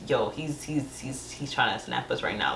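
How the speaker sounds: low, soft, halting heads-up; hushed warning. Retold or imitated. Imitating a friend warning her in quiet church, hushed. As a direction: keep it down, stumbling over the start.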